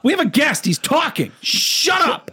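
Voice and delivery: high mock tantrum yell; heated family shouting. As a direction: bicker like a bratty sibling.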